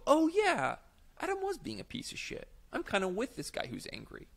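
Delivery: high-pitched voice of dawning recognition; imagined audience member. Acted. Audience member siding with the angry player, high-pitched realisation. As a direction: jump up high as the crowd catching on.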